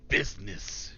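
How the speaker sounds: slow orcish voice; lightly voiced orc. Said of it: "an orcish voice".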